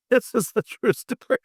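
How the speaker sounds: high, laughing through the words; can barely say it for laughing; real laughter. Real, not acted. Amused by own hilarious story.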